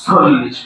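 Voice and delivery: "চল্লিশ" said as sollish; "চল্লিশ" opening on s, not ch; s replacing ch. Shown as a demonstration. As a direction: model "চল্লিশ" with an initial dental s. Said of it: "চ কে দন্ত স উচ্চারণ করবো".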